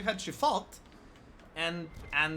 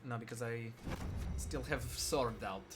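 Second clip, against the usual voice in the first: mock Russian accent; 8 semitones lower, 9 dB quieter than usual. Shown as a demonstration. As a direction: quietly grumble in a bad Russian accent. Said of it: "that was not Russian".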